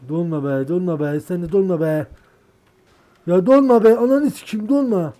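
beg, then scream at it; building to furious scream. Real, not acted. Gamer pleading with a freezing computer, rising into a scream.

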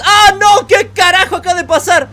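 sudden loud high yelp of shock; shocked outburst. Real, not acted. Gamer yelling as his car flips over, shocked. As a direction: blurt it out as the truck flips.

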